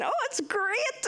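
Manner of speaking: high, drawn-out fake cheer; put-on happiness. Homesick newcomer on FaceTime home, put-on happiness. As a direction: pretend you are loving life. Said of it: "put on this persona that you were loving life".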